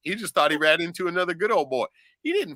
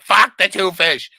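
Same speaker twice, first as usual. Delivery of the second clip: gruff refusal; 5 semitones higher, 10 dB louder, slower than usual. Retold or imitated. Old skipper refusing to continue.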